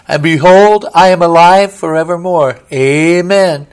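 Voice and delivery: loud, soaring proclamation of triumph; ringing proclamation. Acted. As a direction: proclaim it like a herald to the crowd. Preacher proclaims Jesus' words.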